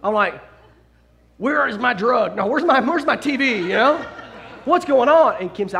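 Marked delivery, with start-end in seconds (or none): 1.4-5.3 high, loud, laughing outrage at the missing TV; mock outraged. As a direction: play up the panic over the lost TV, laughing. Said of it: "And I'm tripping".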